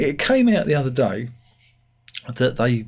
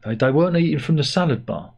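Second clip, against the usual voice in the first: usual speaking voice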